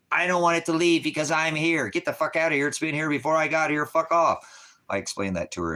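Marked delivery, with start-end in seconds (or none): 0.1-4.5 higher, louder, cursing shout; angry shout. Retold or imitated. Ranting at the lady about the energy, loud angry shout. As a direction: bellow it at the lady like you mean it.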